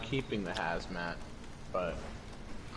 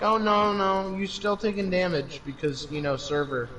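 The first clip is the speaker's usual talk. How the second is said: mock game voice; 8 semitones higher than usual. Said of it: "it's like".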